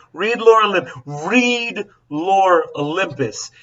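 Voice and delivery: insistent, pleading recommendation; emphatic plea. Real, not acted. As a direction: drag out "Lore Olympus" to push it. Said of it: "urging Henry to read".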